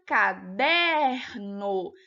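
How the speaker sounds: "caderno" with middle syllable DER loud and stretched; stress on DER. Shown as a demonstration. Teacher showing pupils a paroxítona, stress on DER in "caderno". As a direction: slowly draw out and punch DER in "caderno". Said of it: "Porque o der".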